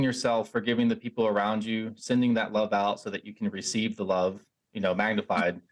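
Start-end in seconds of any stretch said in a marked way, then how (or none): none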